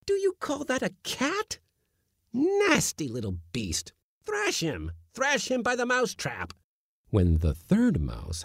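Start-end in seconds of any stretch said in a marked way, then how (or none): 0.1-6.5 very high, exasperated scolding. A narrator's reading. Uncle scolding the kitten to look at the mouse, high. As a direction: berate the cowardly kitten.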